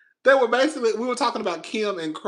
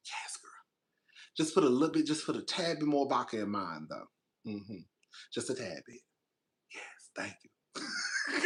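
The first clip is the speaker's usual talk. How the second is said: much softer, low murmur; hushed whisper; 11 semitones lower, 12 dB quieter than usual. Pretend. Pretend ordering a vodka drink from the kid, whispered.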